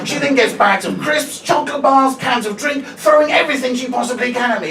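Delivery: usual speaking voice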